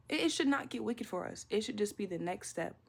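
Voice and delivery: normal talking voice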